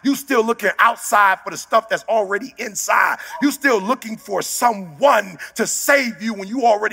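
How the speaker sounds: high, shouting motivational sermon; fiery shouted exhortation; preaching at full force. Acted. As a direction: preach it hard at the crowd.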